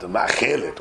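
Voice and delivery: a light parody of fancy Hebrew; mock posh Hebrew. Mocking the Queen's English word for his listeners, lightly posh. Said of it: "that's the Queen's English".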